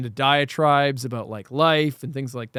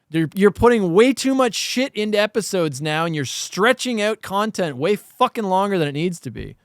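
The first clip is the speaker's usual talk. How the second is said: heated, higher, louder rant; 7 semitones higher, 4 dB louder than usual. Real, not acted. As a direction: vent at filmmakers, building up. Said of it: "I wanna rant for a second".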